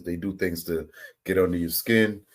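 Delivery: ordinary delivery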